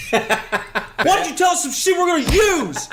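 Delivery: high, drawn-out mock demand; hammed-up, high-pitched demand; mock interrogation. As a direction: yell it slowly through laughter like a hammed-up interrogator. Exaggerated interrogation, imitating the student.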